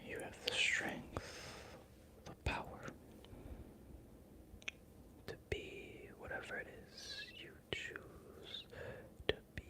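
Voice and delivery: very soft, hushed command; whispered incantation. Real, not acted. Streamer speaking empowerment to the viewers, hushed incantation. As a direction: breathe it out like a quiet spell.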